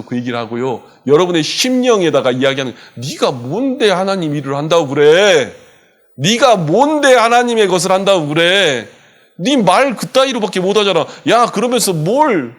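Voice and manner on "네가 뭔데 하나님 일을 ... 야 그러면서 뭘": accusing devil. Retold or imitated. Devil whispering accusations into believers' hearts, scornful. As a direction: belittle them with rising scorn.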